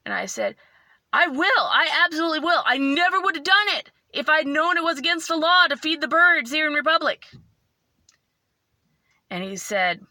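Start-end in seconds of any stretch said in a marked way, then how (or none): 1.1-7.3 high, loud, earnest pleading; rushed, pitched-up protest of innocence; tearful promise. Retold or imitated. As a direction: promise fervently, upset and near tears. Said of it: "look at the tears actually falling".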